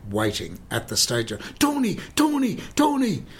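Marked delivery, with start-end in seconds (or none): 1.6-3.2 high-pitched, repeated hail of a name; excited calling out. Retold or imitated. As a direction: imitate someone eagerly calling a friend over. Actor calling out at stage door.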